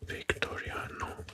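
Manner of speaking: ordinary delivery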